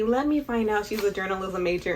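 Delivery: ordinary talk, nothing marked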